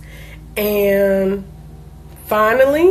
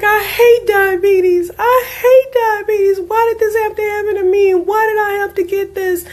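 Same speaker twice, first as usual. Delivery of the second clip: put-on child crying; 11 semitones higher than usual. Mom retelling her son waking up hating diabetes, high tearful crying. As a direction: cry it out like a heartbroken little boy. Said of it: "he just was crying".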